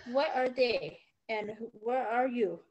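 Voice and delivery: ordinary delivery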